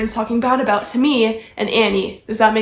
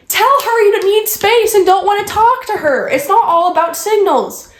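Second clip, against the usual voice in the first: high, loud indignant outburst; exasperated scolding; 8 semitones higher, 5 dB louder than usual. Real, not acted. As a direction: tell the friend off, fed up. Argues back at absent friend.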